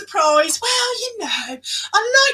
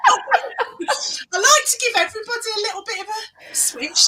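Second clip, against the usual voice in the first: laughing whisper; 6 semitones higher than usual. Real, not acted. Laughing while explaining catchphrase.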